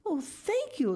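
high, thrilled thanks; grateful exclamation. A narrator's reading. Lucy thanks Miss Pinchin, excited exclamation. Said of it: "exclaimed Lucy".

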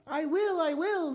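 high, urgent assurance; anxious high-voiced pledge; eager promise. A narrator's reading. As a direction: agree frantically, pitch way up. Mister Gregg promising under threat.

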